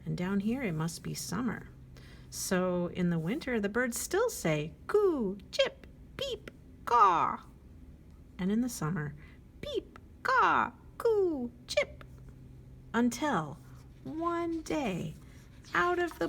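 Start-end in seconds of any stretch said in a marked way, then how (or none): 4.9-7.4 high, loud chirpy bird calls; squeaky birdsong voice. Acted. Birds singing their regular songs. 9.6-12.0 high, loud chirpy bird calls; squeaky birdsong voice. Acted. Birds singing their regular songs.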